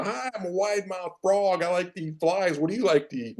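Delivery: crank the frog up high and draw each word out; wide mouth frog. Retold or imitated. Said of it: "and he's, he gets into".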